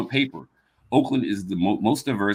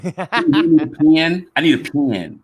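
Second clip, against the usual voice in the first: slow Southern drawl, stretching "pen"; exaggerated Southern twang; 5 semitones higher, 3 dB louder, slower than usual. Shown as a demonstration. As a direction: lean into a Southern accent on "pen". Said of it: "Southern tinge or a drawl".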